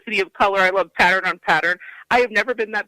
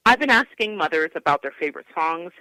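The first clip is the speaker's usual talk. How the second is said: ordinary talk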